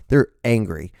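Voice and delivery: ordinary delivery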